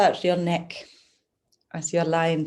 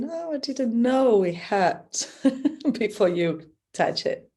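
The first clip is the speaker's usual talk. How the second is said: higher-pitched client quote breaking into a laugh; amused retold surprise; 6 semitones higher, 4 dB louder than usual. Massage therapist retells a client's reaction to touch, amused mock surprise. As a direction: imitate a surprised client, laughing lightly midway.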